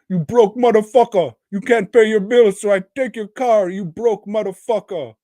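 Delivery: put-on high, loud African-accent rant; quick mock African accent, hollered; Michael Blackson. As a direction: shout insults in a caricatured African accent. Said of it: "talking like a fucking African".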